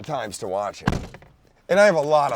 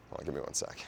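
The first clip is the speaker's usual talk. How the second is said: whispered aside; 10 semitones lower, 15 dB quieter, faster than usual. Real, not acted. Aside off mic mid recording.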